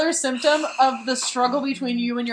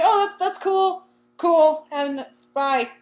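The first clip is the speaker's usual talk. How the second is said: slow, higher-pitched feigned cool; acted awkward nonchalance; 6 semitones higher, 4 dB louder, much slower than usual. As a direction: stammer that it's fine after rejection. Acted awkward reaction to rejection.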